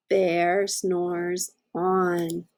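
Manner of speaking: slow, drawn-out storybook refrain. Acted. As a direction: let the refrain linger slowly. Refrain read to children.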